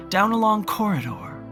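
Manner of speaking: normal talking voice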